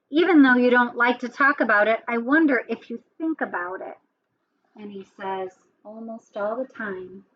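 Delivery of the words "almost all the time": very soft, withdrawn storybook elephant; hushed, reluctant character voice; quiet admission. A narrator's reading. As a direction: admit it almost under the breath. Elephant reluctant to talk, read aloud.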